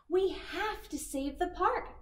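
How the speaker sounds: high excited cry; cried out. Acted. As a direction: cry out with urgency. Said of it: "cried Poppy".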